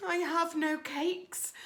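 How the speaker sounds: timid rabbit. Acted.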